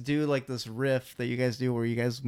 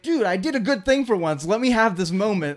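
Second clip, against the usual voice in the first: pleading whine; 8 semitones higher, 7 dB louder than usual. Retold or imitated. Retold plea to friend.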